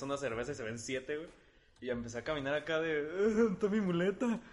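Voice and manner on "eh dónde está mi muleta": put-on slurred, slow drunk mumble pitched up; a slow, hammed-up drunk asking for his crutch; drunk self. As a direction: play yourself wasted, groping for a crutch. Drunk self stumbling about.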